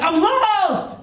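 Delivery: high, drawn-out call of a lost man; acted yell. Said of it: "He started yelling".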